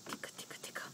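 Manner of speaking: hushed whispered chant. Shown as a demonstration. Parent whispering a word for baby to copy, hushed.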